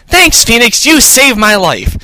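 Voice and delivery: high-pitched, gushing thanks; grateful Maya. A narrator's reading. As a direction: thank your rescuer in a high voice. Maya thanking her lawyer.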